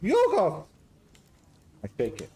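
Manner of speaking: high, loud hammed-up Greek-Scottish accent; a jolly Greek cook with a Scottish lilt, up high and loud; garbled Greek-Scottish accent. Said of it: "Garbled Greek Scottish".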